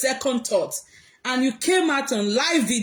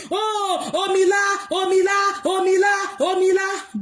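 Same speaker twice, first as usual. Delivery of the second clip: mock wailing crowd; 6 semitones higher than usual. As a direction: chant it like a crowd wailing in pity. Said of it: "everybody came on Dion Osagie".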